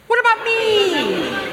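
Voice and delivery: high whiny voice with "me" drawn out long; stress on "me". Shown as a demonstration. Mother leading the congregation in the family saying, whiny long "me". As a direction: lean hard on "me" in a slow childish whine. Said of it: "You got emphasis on the me".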